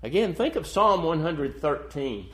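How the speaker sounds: normal talking voice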